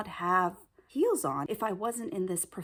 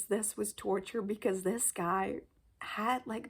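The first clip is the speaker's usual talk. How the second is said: ordinary delivery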